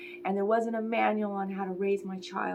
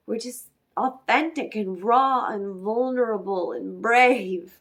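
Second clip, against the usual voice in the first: rising passion; 8 dB louder than usual. Acted. Builds to emphatic peak.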